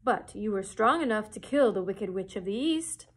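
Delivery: loud, looming wizard question; booming Oz. Acted. The great Oz challenges Dorothy, loud and looming. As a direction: boom the question down at her. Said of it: "said Oz".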